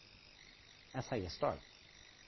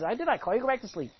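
put-on higher, firm old priest telling him off; mild scolding; 7 semitones higher, 3 dB louder, faster than usual. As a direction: send the boy back to bed. Eli scolding the boy.

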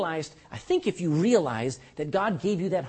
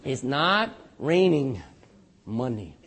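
slow emphasis; 6 semitones lower, slower than usual. Real, not acted. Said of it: "slowed for emphasis".

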